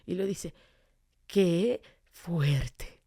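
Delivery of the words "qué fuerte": slow, low stunned reaction; stunned girl. Retold or imitated. Girl on the train reacts to the evangelist, stunned. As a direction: draw it out in wide-eyed amazement. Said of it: "abre los ojos grande".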